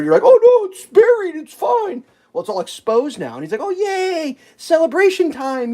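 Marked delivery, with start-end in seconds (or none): 0.2-2.0 very high, dismissive put-on voice; mock reassurance. Mocking the city official. 3.6-5.7 high, drawn-out fake cheer; mock celebration. Mocking official's fake celebration.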